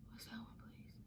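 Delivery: barely audible whisper to self; very soft, voiceless self-questioning; whispered aside. Real, not acted. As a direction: whisper it under your breath while trying to remember. Aside to self while recalling a name.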